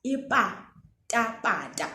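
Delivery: "Ipatapata" slow with plain unaspirated p; teacher repeats slipper word; slow word demo. Shown as a demonstration. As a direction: draw out "Ipatapata" gently. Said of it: "That's the pa".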